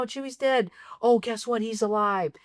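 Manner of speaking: normal talking voice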